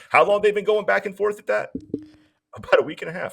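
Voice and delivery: high-pitched mock innocence; feigned ignorance. Acting coach who heard nothing.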